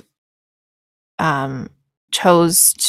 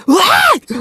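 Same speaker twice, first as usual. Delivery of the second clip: high, loud mock snap of "What?"; imitating a sudden "What?"; 6 dB louder than usual. Dad walks into the room and snaps at his kid, mock loud "What?". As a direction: bark it out like an annoyed dad barging in. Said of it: "someone walks in and just goes".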